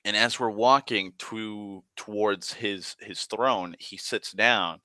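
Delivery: ordinary delivery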